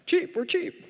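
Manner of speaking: eager pitch. Retold or imitated. Salesman's eager pitch to customer.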